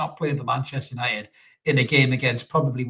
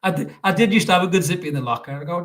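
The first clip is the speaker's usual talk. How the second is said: usual speaking voice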